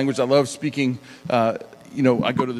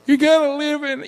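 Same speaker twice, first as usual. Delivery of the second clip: high, loud comedian impression; Chris Farley; 13 semitones higher, 5 dB louder than usual. Impressionist jumping into a voice, loud Chris Farley impression. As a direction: burst out high and loud in a put-on voice. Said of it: "Or, or Chris Farley".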